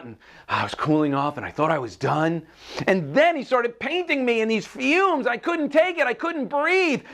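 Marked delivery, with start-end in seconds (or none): 2.9-7.0 high, overwhelmed telling; frantic distress. A narrator's reading. Teacup choked by paint fumes, frantic high telling. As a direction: tell it in a high panic.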